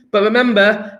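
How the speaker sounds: ordinary delivery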